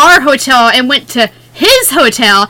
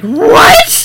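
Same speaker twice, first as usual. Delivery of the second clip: shocked what; 9 semitones higher than usual. Retold or imitated. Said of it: "she's like".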